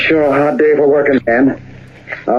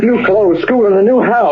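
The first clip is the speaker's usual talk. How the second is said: eager dreaming; 6 semitones higher than usual. Acted. Dreaming of what gold buys.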